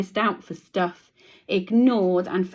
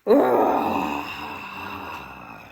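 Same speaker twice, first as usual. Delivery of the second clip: dramatic gasp. Acted. Reacting to scary lion.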